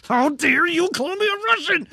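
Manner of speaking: loud, high-pitched imitation of an indignant fighter; mock outrage. Podcaster imitating J C V D's reaction to an insult, mock outrage. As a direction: act wildly offended, voice shooting up. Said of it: "he's so angry about it".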